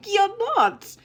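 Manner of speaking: high, loud, laughing through the words. Real, not acted. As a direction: laugh it out while denying.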